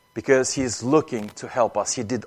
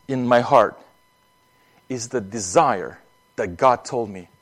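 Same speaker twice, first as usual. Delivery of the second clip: ordinary delivery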